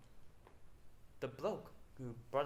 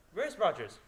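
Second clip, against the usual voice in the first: calling out; 6 semitones higher, 11 dB louder than usual. Acted. Calling out for missing butler.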